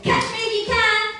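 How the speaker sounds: high, loud gleeful pig shout. A narrator's reading. Pig daring his brother to chase him, gleeful high shout. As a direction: shout it like a giddy piglet starting a chase. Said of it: "gleefully shouted".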